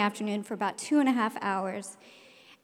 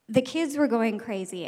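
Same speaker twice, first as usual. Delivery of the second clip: ordinary talk, nothing marked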